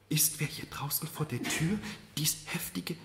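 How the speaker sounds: hushed whisper at the door; whisper, straining to listen; whispered question. Acted.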